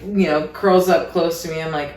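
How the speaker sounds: usual speaking voice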